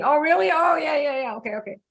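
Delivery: high, light "oh really?" then trailing off; casual surprise. Retold or imitated. Shrugging off a bleeding wound, mock casual surprise. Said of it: "you didn't even notice".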